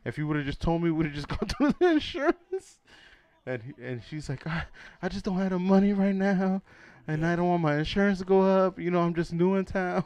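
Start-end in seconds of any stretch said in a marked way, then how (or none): 0.0-2.8 higher, louder mock indignation; comic wounded outrage; imitated pissed-off protest. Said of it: "he got pissed". 4.5-10.0 high, loud mock-plaintive voice; comic higher-voiced plea; imitated pleading excuse. Acts out her excuse to him.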